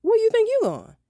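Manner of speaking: high sharp mom voice; mom challenging. Retold or imitated. Mom stopping her daughter going to bed, high and sharp. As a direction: raise it way up, a suspicious mother. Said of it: "looked me dead in my face and said".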